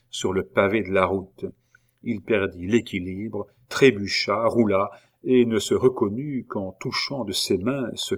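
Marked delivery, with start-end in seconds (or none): none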